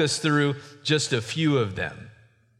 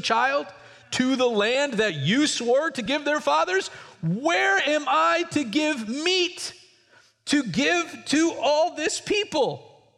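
anguished Moses; 9 semitones higher than usual. Acted. Moses complains to God.

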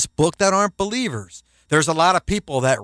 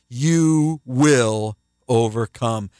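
slow, lower, assured; reassuring; 5 semitones lower, much slower than usual. Acted. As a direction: promise it gently and firmly. Assurance to believers.

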